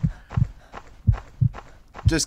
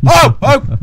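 startled yelp; 12 semitones higher, 12 dB louder than usual. Real, not acted. Scared by game.

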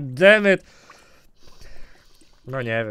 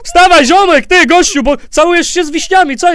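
calling out; 8 semitones higher, 8 dB louder than usual. Pretend. Calling out to wake the rat.

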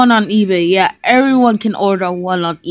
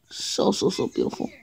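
awed whisper; 2 semitones lower, 11 dB quieter than usual. Acted. Awed whisper over trophy.

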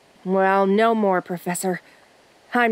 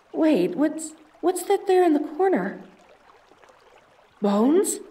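higher, wary voice; sudden unease at what she sees; uneasy alarm; 7 semitones higher, slower than usual. Acted. Explorer spotting bones in the corner, uneasy.

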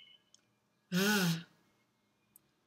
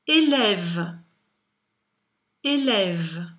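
careful dictation; 2 semitones lower, 3 dB louder, much slower than usual. Shown as a demonstration. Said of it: "en disant le mot".

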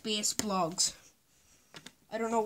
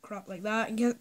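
ordinary talk, nothing marked

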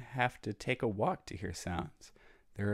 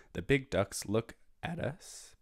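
ordinary delivery, nothing marked

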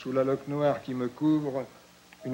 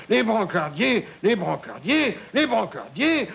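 shouting for help; 7 semitones higher than usual. Retold or imitated. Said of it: "que je crie".

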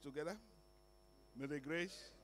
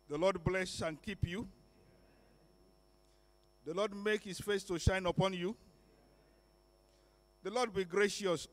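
louder, solemn pronouncement of the blessing; spoken benediction; 3 semitones higher, 5 dB louder than usual. Acted. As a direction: lift your voice and bless the congregation. Pastor pronounces the priestly blessing.

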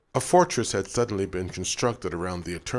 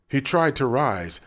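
ordinary delivery

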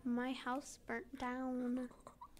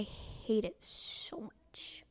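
hushed, slow whisper; much slower than usual. Real, not acted. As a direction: breathe it out unvoiced.

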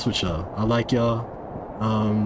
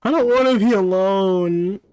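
scared wail; 7 semitones higher, 4 dB louder than usual. Acted. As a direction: plead like a scared child, stretching it out. Scared child character left alone.

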